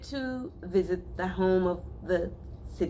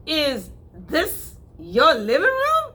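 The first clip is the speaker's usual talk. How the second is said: sneering; 10 semitones higher, 7 dB louder, slower than usual. Acted. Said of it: "he asked with a sneer".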